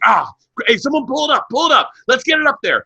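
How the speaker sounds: high, excited shock-jock imitation; Opie. Radio host calling for the clip, hyped-up impression. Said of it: "Opie's like".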